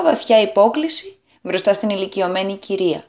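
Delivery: ordinary delivery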